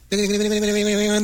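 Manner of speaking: fast, high strumming "ネネネ" like a mandolin; mandolin imitation. Host imitates a mandolin to the guest, quick and high. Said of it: "ってやつだよね".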